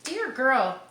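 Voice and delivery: usual speaking voice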